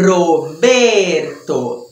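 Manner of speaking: Roberto said very slowly, the R trilled strongly; rolled Spanish R. Shown as a demonstration. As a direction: draw out Roberto and trill the R hard. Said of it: "Tem que falar com vontade".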